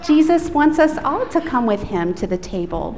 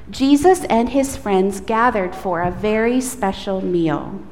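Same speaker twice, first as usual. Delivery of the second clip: usual speaking voice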